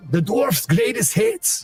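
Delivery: goofy high dwarf; cartoon dwarf. Shown as a demonstration. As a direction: squeak it out like a little dwarf. Mock character voice.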